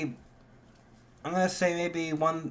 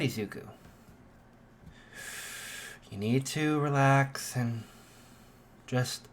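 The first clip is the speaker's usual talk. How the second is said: self-soothing. Acted. Izuku soothes himself through panic.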